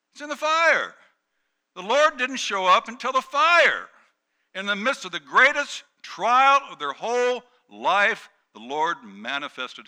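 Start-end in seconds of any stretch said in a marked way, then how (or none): 0.1-8.3 very high, shouted sermon climax; loud, soaring, emphatic preaching; preaching at full force. Real, not acted. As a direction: cry it out to the whole congregation.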